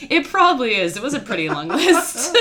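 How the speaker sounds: laughing. Real, not acted. Laughing at constipation joke.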